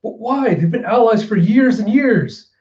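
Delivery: high, loud disbelief; shocked bystander. Retold or imitated. Samurai stunned by the killing, high and loud. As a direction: gasp out disbelieving questions. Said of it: "And you have".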